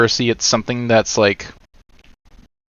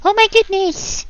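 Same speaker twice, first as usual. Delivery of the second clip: cartoon voice; much slower than usual. Greeting chat in cartoon voice.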